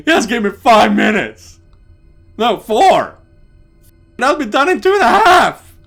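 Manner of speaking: high, shouted plea; frantic bargaining. Acted. Bargaining for more time.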